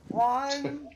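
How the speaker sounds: gangster countdown. Acted. Friend counts down in mock gangster voice. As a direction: bark the count like a threat. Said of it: "Hey, Pesci".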